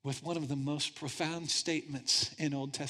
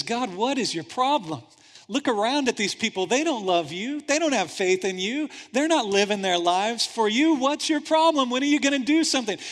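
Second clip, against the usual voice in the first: loud, high exasperated complaint at God; griping prophet; 6 semitones higher, 8 dB louder than usual. Acted. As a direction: shout your frustration up at heaven.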